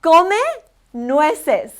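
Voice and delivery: deliberate teacher model; slow Spanish drill. Shown as a demonstration. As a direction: Come loud and rising, nueces falling. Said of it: "give it your all".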